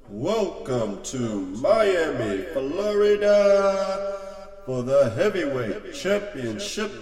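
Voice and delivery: slow, stretched, high booming announcer; boxing ring announcer. Acted. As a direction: draw out every name to the crowd. Ring introduction before the fight.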